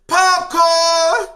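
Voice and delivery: high loud yell of Popcorn; warning scream. Retold or imitated. Doodoo warning the crew about police, screamed high. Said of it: "Doodoo screaming".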